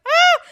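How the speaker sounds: high, loud "ah!"; a short startled cry; alarmed yelp. Retold or imitated. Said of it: "And I was like".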